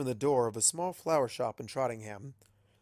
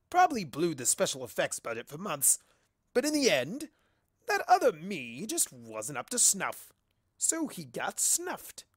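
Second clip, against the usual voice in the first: smug trickster; 4 semitones higher than usual. Acted. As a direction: gloat like a cheeky chaos spirit. Discord boasting smugly.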